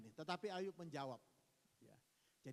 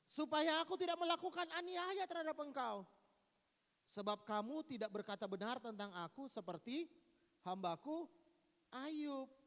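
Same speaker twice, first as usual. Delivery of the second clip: higher, louder proclaiming; God's declaration; 7 semitones higher, 5 dB louder than usual. Acted. Preacher voicing God accepting Job's prayer. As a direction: announce it solemnly.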